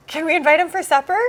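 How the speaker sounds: high, loud, fast eager request; excited child. Retold or imitated. Child begging mom to invite Jesus, high and loud. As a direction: blurt it out like a thrilled little kid. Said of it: "She said".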